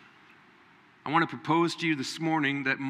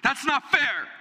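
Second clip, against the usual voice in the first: higher, louder whine with "not" punched; childish wail; 12 semitones higher, 4 dB louder, slower than usual. Retold or imitated. Preacher mimics a child crying out at unfairness. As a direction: cry "not" like a kid's protest. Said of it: "The cry of the American child".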